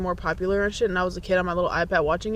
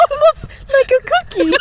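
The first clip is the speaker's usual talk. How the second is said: high, loud line broken by laughter; laughing mock tenderness; 6 dB louder than usual. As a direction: giggle through the words. Laughing while retelling.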